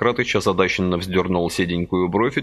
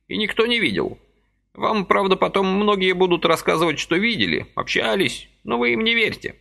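put-on high fussy old man's voice, gently reproaching; a little old man chiding kindly; old man reproaching Vasily for his haste, high and fussy; reproachful old man; 12 semitones higher than usual. A narrator's reading.

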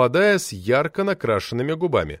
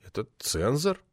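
quiet mumbled "цензор", falling away; nervous young editor mumbling "цензор"; 7 dB quieter than usual. Acted. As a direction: mumble "Это цензор" sheepishly. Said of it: "промямлил я".

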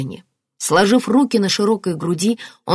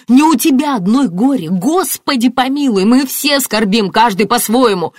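angry yelling; 7 semitones higher, 6 dB louder than usual. A narrator's reading. Martin on the whole family's grief, yelling. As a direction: shout her down, stressing each phrase. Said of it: "заорал Мартин".

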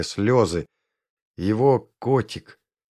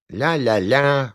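put-on slow, higher baby babble; cooing toddler; 4 semitones higher, slower than usual. A narrator's reading. Three-year-old clinging to Моревна's leg, cooing. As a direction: coo it slowly like a little boy. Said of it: "ворковал Семён".

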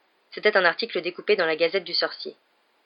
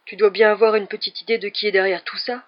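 probing question in a put-on voice; tentative question; 2 semitones higher, 3 dB louder than usual. A narrator's reading. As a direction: venture it as a careful probe. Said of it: "risqua Harry".

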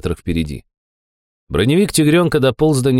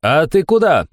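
raised, higher-pitched hail; calling after someone; 7 semitones higher than usual. A narrator's reading. Narrator hails the man walking off, calling out. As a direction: call out to stop him. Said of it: "окрикнул его я".

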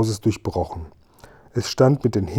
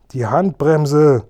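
louder and higher, slow call over engine noise; shouting; 4 semitones higher, 4 dB louder, much slower than usual. A narrator's reading. Kasperl and Seppel yell to the driver over the engine, loud shout. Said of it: "riefen Kasperl und Seppel".

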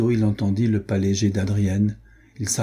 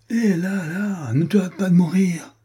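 hasty checking; 9 semitones higher than usual. A narrator's reading. Wounded man stops his friend's suicide, high-pitched checking. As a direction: call him off in a much higher voice.

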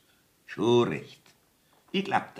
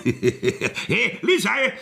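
laughing call; 12 semitones higher, 5 dB louder, much slower than usual. Acted. Judge calls the maid, laughing.

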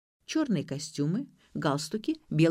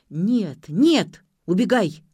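loud, urgent refusals; frantic outburst; 8 dB louder, much slower than usual. A narrator's reading. Leon frantic to get Ksyu out, frantic outburst. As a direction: lose control and order her off. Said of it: "пошёл в разнос Леон".

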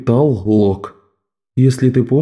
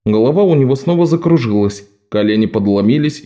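usual speaking voice

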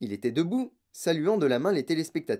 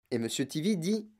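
ordinary delivery

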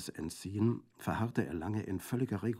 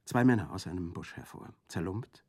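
usual speaking voice

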